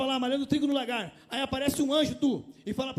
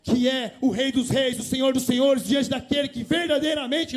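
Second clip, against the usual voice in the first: loud, slow, drawn-out preacher's cry; full-voiced pulpit declaration; preaching climax; 7 dB louder, slower than usual. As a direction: lift it to a fervent peak.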